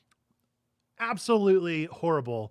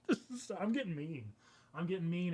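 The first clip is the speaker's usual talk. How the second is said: real giggling; 2 semitones higher, 9 dB quieter than usual. Real, not acted. Cracking up before the slogan.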